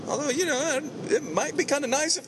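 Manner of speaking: high, teasing voice; playfully greedy aside; joking falsetto. Acted. Joking wish for sponsor cash.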